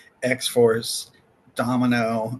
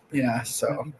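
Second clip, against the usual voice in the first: ordinary talk, nothing marked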